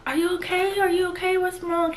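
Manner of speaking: high, soft worried voices; mimicking anxious onlookers, high and soft; imitated concern. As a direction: fuss over someone in a small high voice. Everybody reacting to her crying.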